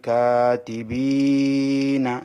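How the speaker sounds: very slow chanted কিরামান কাতিবিনা; measured tajweed chant; Quran recitation. Shown as a demonstration. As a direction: recite it like in the mosque, stretching the alif. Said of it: "এখানে এক আলিফ টানতে হবে".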